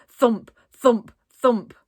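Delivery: loud thumping on a wall; banging sound. A narrator's reading. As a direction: knock out three heavy thumps.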